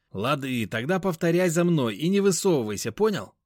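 high, pushy instructing; a brisk, bossy young guy; bossy friend voice. A narrator's reading. Веник инструктирует рассказчика. (бойкий командный голос)

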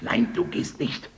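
stern slow whisper; whispered order. Acted. Wife stopping her husband from opening, whispered. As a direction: forbid it in a hiss. Hushed command.

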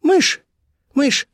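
very high, quick squeak of words; Colibri, hurried. A narrator's reading. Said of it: "поспешно проговорила Колибри".

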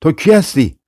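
shouted challenge. A narrator's reading. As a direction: call out a challenge without full force. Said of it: "فریاد کشید که". (با فریادی ملایم و صدای زیرتر)

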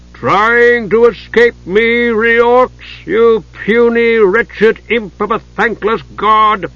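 high, loud, slow sneering giant; menacing giant. A narrator's reading. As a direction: sneer down at the cowering dwarf.

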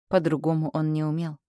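plain reading voice

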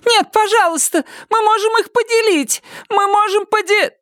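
very high-pitched frantic plea; desperate high pleading, cut off at the end; pleading cry. A narrator's reading. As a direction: beg in a high woman's voice. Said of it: "крикнула женщина".

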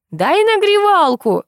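very high, drawn-out yell across yards; full-throated call. A narrator's reading. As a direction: holler the request, stretching it out. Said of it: "во всё горло крикнула". (протяжно кричит соседу во всё горло)

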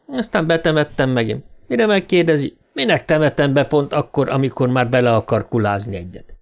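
usual speaking voice

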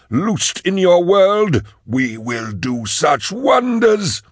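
high, slow otherworldly villain voice; villainous Magos. Acted. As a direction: declaim high and slow, like something possessed. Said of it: "said Sark".

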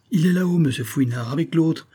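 eager concierge. Acted. Excited concierge reports to Fouinard.

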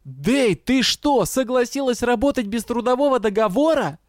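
high, incredulous roar; outraged roar. A narrator's reading. Дроу turns on confused colleague over missing contract, roaring accusation. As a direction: explode in disbelief at a partner. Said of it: "и взревел".

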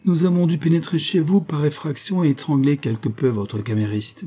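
airy, untroubled explanation; matter-of-fact. A narrator's reading. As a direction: announce it as perfectly natural. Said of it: "la chose la plus naturelle du monde".

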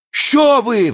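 high wailing protest; very high, pleading wail; thin howl. A narrator's reading. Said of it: "взвыл тонкий голос".

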